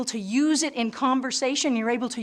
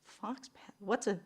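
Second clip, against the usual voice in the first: hushed, baffled questioning; half-whispered confusion, feeling out the strange word; puzzled friend; 3 semitones lower, 11 dB quieter than usual. Acted. Friend puzzled by an unknown word, hushed and baffled. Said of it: "your friend looks at you and goes".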